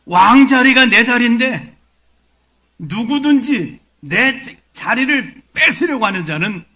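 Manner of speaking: high, very loud royal declamation; acted kingly outrage; possessive King Saul. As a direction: thunder it as a king guarding the throne. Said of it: "내 자리라고 생각합니다".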